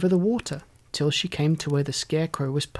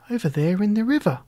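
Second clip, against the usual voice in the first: put-on higher, bright girl's voice; lightly voiced little girl; 5 semitones higher than usual. A narrator's reading. Dorothy introducing herself to the stork, lightly higher girl voice. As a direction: lift the pitch for the little girl.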